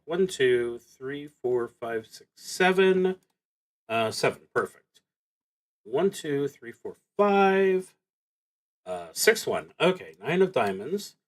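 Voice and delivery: ordinary talk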